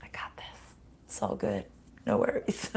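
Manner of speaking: hushed whisper, breath only. Retold or imitated. Picking up heavy dumbbells at the gym, soft whisper. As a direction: whisper it like a secret boast. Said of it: "and say".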